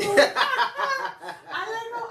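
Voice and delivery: high, very slow through laughter; laughing. Real, not acted. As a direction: drag it out while laughing. Laughing at being called them.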